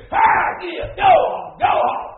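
loud, high holler driving a horse; full-voiced farmhand command; horse handler's shout. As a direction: yell it out to get the animal moving. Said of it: "He don't whisper to him".

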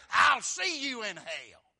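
high, slow full-force holler; drawn-out mock bravado at the top of the voice; defiant shout. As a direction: shout it as a cocky taunt. Men's boast to each other, acted.

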